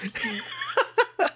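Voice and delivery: breathless high laughter. Real, not acted. Laughs hard.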